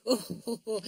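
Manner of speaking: high, hooting ho-ho laugh; hearty storybook guffaw; roaring laugh. A narrator's reading. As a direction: burst into a belly laugh. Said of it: "roared Big Ears".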